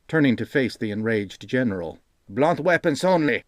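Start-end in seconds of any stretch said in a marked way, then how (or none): 2.3-3.4 higher, sharp order to soldiers; commanding. A narrator's reading. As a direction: give the order to the troops. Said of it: "he commanded his men".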